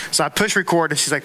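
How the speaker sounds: ordinary talk, nothing marked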